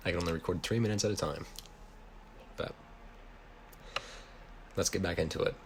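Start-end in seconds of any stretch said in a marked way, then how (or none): none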